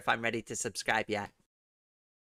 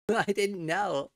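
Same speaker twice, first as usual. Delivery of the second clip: laughing; 6 semitones higher, slower than usual. Real, not acted. Laughing at uwu voice idea.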